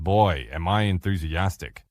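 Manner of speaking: low, slow, lifeless group chant; unenthusiastic. Retold or imitated. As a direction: drag it out as if nobody wants to say it. Come on, let's try it again.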